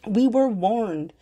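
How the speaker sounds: slow, high, stressed; emphatic. Real, not acted. Vlogger stressing the climate warning, slow and emphatic. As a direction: hammer the words slowly.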